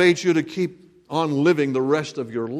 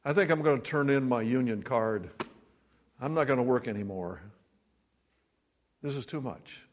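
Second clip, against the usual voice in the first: weary giving up; 4 semitones lower, 7 dB quieter than usual. Retold or imitated. Worker ready to quit.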